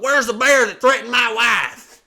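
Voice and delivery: very loud, high hammed-up roar; challenging yell. Armed husband runs outside to defend wife, loud challenging yell. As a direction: bellow it as a mock-heroic husband.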